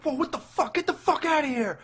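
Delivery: high, panicked mock yell, acting startled; freaked-out reaction. Nico reacting to Pinhead popping up, high panicked mock yell. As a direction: squeal it out like a kid who just saw a monster. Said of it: "I love the reaction. He's like".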